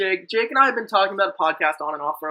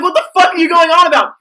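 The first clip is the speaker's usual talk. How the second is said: high, loud mock-baffled yell; exasperated retold outburst; 10 semitones higher, 9 dB louder than usual. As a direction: re-enact yelling at a baffling friend. Retold exasperated reply to bad joke.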